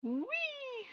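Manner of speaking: high playful squeal; gleeful whee. Pretend. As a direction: squeal like on a slide. Joy at the magical hook.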